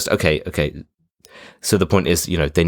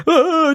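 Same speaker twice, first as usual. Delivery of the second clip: mock panicked yell; 5 dB louder than usual. Panicking soldiers firing everywhere.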